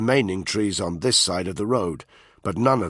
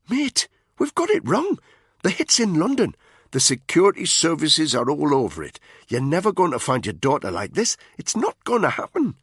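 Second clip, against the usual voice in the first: higher voice, pleading with his mate; worried soldier urging his friend; Spud, urgent; 8 semitones higher, 4 dB louder than usual. A narrator's reading. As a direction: push him to see sense, pressing.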